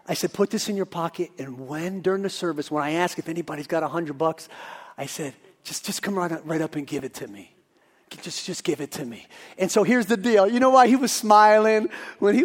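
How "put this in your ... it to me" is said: low, hushed secret instructions; retold whisper. Preacher privately briefing Phil before the service, hushed. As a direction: murmur it like a plan between two people. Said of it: "I whispered to Phil".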